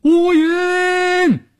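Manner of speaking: high, drawn-out call of a name; blurted shout. A narrator's reading. Shaoyue spots Wuyun singing on the boat, high blurted shout. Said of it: "邵月脱口喊道".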